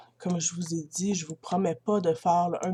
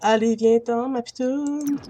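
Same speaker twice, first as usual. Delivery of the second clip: higher, lilting call; calling over; 4 semitones higher, 4 dB louder than usual. Pretend. Player calling her Sim to come, singsong call. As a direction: coax her along with a raised call.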